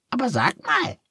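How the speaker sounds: very high, squeaky little duck, curious; a cheerful duck asking kindly. A narrator's reading. Duck asks.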